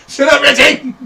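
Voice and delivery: very high, loud screech; angry shout. Pretend. Yelling at Richie to shut up, furious shout. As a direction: blow up and shriek it at him.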